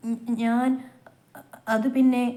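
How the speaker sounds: stammering, caught off guard; faltering. Acted. Sister caught out by her elder sister, faltering. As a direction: stumble over the words, lost for an answer. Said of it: "Dachu മറുപടിയില്ലാതെ പതറി".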